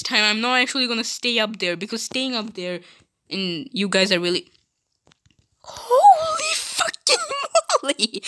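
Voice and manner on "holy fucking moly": very high, slow, wailing exclamation; shocked outburst. Acted. Character stunned by the others, slow high wail. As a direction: stretch it out in high disbelief.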